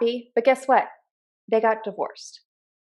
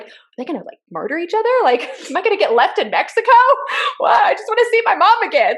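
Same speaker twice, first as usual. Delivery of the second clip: laughing mock panic; 9 semitones higher, 3 dB louder, faster than usual. Retelling childhood fear, laughing.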